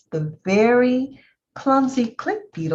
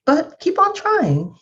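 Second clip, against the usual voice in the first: encouraging turtle; 10 semitones higher, 5 dB louder than usual. Acted. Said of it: "said the turtle".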